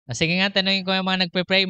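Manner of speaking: ordinary delivery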